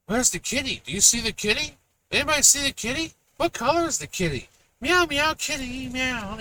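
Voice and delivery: put-on high sing-song baby talk; reading to a toddler. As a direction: coo it like a kids' show host. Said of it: "Kids shows now are".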